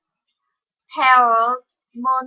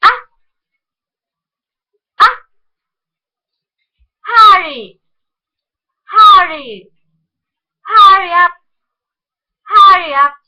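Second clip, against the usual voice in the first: loud urgent call; 4 semitones higher, 6 dB louder than usual. Shown as a demonstration. Teacher calls out the drill urgently. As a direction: Hurry called out loud, urgent. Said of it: "the monophthong".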